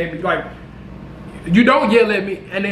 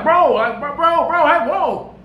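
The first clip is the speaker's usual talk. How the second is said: backing off; 6 semitones higher than usual. Retold or imitated. Telling a pointing man to back off.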